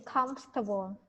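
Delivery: "comfortable" squeezed into three syllables, COMF-ta-bl; teacher's careful three-beat "comfortable"; three-syllable pronunciation. Shown as a demonstration. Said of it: "no, three".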